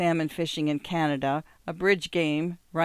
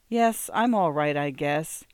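usual speaking voice

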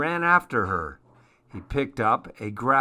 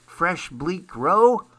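cheerful frog voice; 7 semitones higher than usual. Acted. Happy Frog serves customers.

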